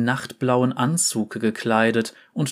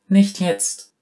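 höheres, lauteres Abwimmeln; ungeduldig abwehrend; 7 semitones higher, 4 dB louder than usual. Acted. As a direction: Oriana weist die schwebende Kugel ungeduldig ab. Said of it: "sagte sie zur Kugel".

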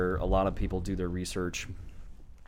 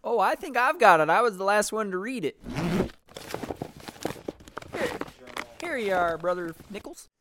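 higher, gentle scholar voice; character voice; 10 semitones higher than usual. Acted. Landis handing journal to Brother Nichols, higher voice. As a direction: play the helpful academic.

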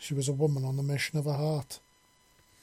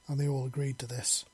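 usual speaking voice

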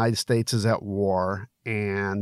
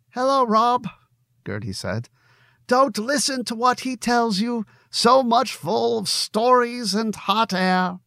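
lightly voiced wife, higher and teasing; a hint of a woman scolding playfully; Gertie; 12 semitones higher than usual.

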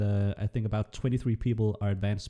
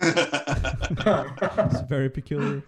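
laughing; 3 semitones higher, much slower than usual. Real, not acted. Laughing at the chat comment.

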